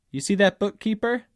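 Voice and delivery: a hint of a foreman opening a chat; foreman talking. Foreman to me in a large factory, lightly acted. Foreman's quoted speech in essay.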